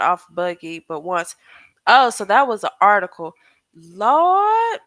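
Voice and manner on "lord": surprised. Real, not acted. Reacting to the article.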